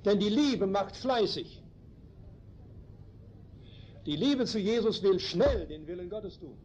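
usual speaking voice

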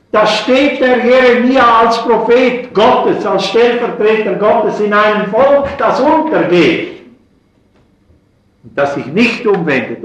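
loud, high impassioned preacher voice; fervent preaching. Acted. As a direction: preach it at full force from the pulpit. Preacher rising to passion.

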